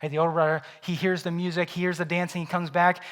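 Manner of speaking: ordinary talk, nothing marked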